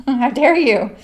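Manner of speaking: playful how dare you; hammed-up offended gasp of a line; mock outrage. Joking with chat about outgrowing candy, mock outrage. Teasing viewer about taste buds.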